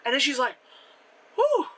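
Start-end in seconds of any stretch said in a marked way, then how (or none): 1.4-1.7 high, puffed exclamation; exaggerated sigh of relief; mock relieved whew. Voicing the princess after the kiss, mock relief.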